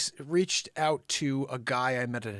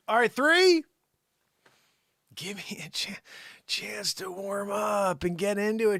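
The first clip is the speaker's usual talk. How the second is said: actor impression; 6 semitones higher than usual. Actor's line retold to George Lucas.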